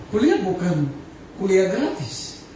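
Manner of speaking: normal talking voice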